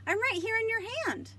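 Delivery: high, loud, fast trickster voice; enjoy fooling him; sneaky snake. Acted. Snake tricking Dragon from the apple, high and quick.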